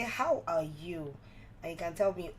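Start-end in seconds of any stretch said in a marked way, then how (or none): 0.0-1.1 slow, deliberate "How are you?"; drawn-out asking. Shown as a demonstration. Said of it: "another way I can say".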